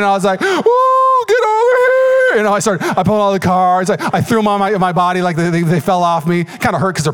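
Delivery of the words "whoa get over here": much higher, louder, slow whooping shout; triumphant shout. Retold or imitated. Winner grabbing the whole pile, hammed-up triumphant shout. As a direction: whoop as you drag the pot in. Dramatic win, pulling the cards in.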